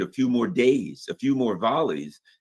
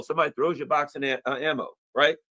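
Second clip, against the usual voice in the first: usual speaking voice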